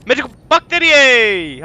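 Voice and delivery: slow, loud, high call; drawn-out shout. Real, not acted. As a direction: stretch the last word into a long falling shout. Calls out for batteries in game.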